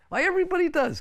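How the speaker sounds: high surprised retort; mock surprise. Real, not acted. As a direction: scoff in disbelief. Exclaiming at a funny claim.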